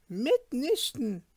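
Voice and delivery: landlady grudgingly refusing the student's request; grumpy. Acted. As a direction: drag the "no" out grudgingly. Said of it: "entgegnete sie grämlich".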